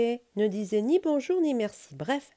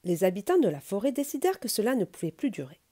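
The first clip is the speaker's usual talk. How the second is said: ordinary delivery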